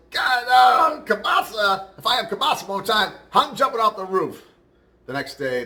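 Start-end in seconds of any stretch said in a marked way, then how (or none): 0.1-4.4 high, loud mock outburst; exasperated Polish guy. Polish guy finds kielbasa for lunch, exasperated shouting. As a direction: shout it like a fed-up Polish worker in a joke. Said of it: "And the Polish guy goes".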